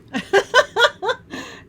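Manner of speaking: high, loud laugh at her own slip; high burst of laughter. Real, not acted. Crafter laughing at misreading her stamp, real laugh. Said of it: "Oh, if you only knew".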